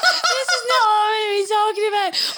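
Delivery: high, loud, laughing protest. Real, not acted. Derailed by co-host's bowel joke, laughing helplessly. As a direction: squeal it out through real giggles.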